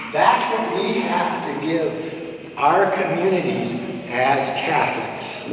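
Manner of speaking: ordinary talk, nothing marked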